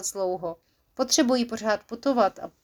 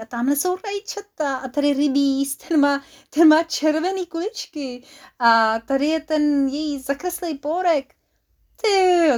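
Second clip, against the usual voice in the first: amazed delight; 5 semitones higher, 4 dB louder than usual. Acted. Beetle marvels at the garden.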